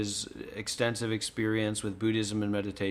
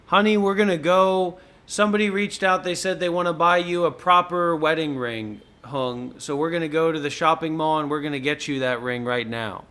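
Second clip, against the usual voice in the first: excited; 5 semitones higher, 4 dB louder than usual. Real, not acted. Announcing the wedding ring trip.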